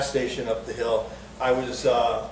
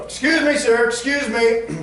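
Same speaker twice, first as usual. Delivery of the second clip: higher, louder polite interruption; heckler interrupting; 10 semitones higher, 4 dB louder than usual. Retold or imitated. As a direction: call out from the crowd. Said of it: "and I go".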